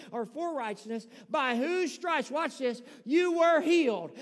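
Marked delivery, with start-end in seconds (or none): 1.3-4.1 slow, building to a loud declaration; loud declared verse; scripture proclaimed. Acted. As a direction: land the healing line hard. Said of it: "First Peter two twenty-four".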